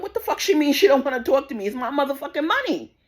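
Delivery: replaying her own outraged yell; retold outburst. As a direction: blow up at the bank staff. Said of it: "I'm like".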